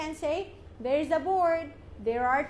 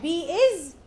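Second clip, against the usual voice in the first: usual speaking voice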